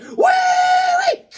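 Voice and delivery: very high, loud squeal of delight; squeaky whoop. Pretend. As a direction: squeal like a kid on a ride. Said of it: "and he's like".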